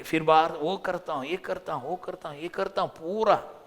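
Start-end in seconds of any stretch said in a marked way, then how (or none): none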